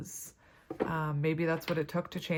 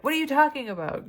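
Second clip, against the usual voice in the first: high, loud, fast indignant denial; mock defensive retort; 9 semitones higher, 6 dB louder, faster than usual. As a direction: play the offended ex brushing it off, fast and high. Quarrel with the person, their denial.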